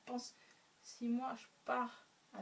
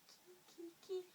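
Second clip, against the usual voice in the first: much higher, puzzled "qui, qui?"; a startled question, pitched up; surprised who; 8 semitones higher, 7 dB quieter than usual. A narrator's reading. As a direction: neighbours asking who attacks. Neighbours demand who.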